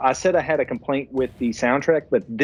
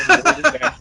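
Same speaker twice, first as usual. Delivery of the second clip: laughing; 14 semitones higher, 5 dB louder, faster than usual. Real, not acted. Laughing at his own story.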